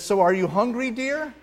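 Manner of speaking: lightly voiced syrupy wife; sweet wife. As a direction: coo it like a sitcom wife. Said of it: "then she says".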